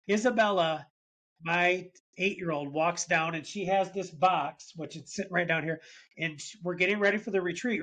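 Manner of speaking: usual speaking voice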